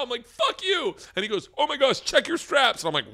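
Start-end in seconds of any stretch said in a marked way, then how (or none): 0.3-1.0 high, loud, panicked defiance; mock outraged yell. Terrified skydiver answering the instructor's order, mock outraged yell. 1.6-2.8 high, loud imitation of alarm; mock alarm. Instructor warning the skydiver strapped to him, mock alarm.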